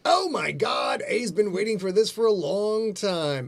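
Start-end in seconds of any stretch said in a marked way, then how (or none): 0.0-1.0 excited shout. Real, not acted. Host shouting with excitement about cupcake day. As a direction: blurt it out thrilled.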